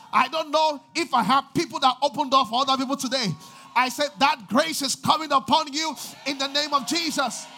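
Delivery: loud, fervent preacher's cry; shouting the sermon's climax; full-force preaching. Acted.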